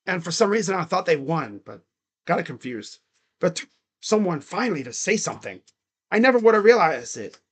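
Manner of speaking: normal talking voice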